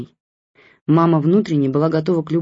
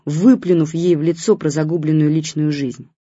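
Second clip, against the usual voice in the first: ordinary delivery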